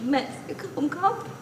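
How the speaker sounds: sobbing whisper. Acted. Mother to her departing son, sobbing softly. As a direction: whisper it while fighting back sobs. Mother holding back sobs.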